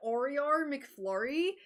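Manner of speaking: hammed-up Australian customer; imitated Australian accent. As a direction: exaggerate the Australian vowels. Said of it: "impersonating Australian accents".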